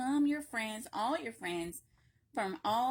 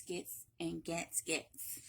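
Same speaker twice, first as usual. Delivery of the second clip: fading repetition; 5 semitones lower, 5 dB quieter, much slower than usual. Acted. Repeated word fading out.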